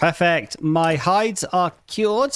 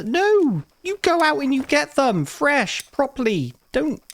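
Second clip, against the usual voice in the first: exasperated scolding; 11 semitones higher than usual. Real, not acted. Scolding imagined lazy worker.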